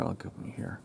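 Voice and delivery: whispered aside to himself; under his breath. Real, not acted. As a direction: mutter it half unvoiced. Aside while working.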